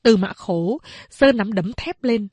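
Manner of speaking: usual speaking voice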